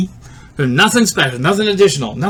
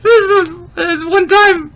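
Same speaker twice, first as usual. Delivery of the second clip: high, slow, giggly start; through laughter; 8 semitones higher, slower than usual. Real, not acted. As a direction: try to speak while still cracking up. Recovering from laughing fit.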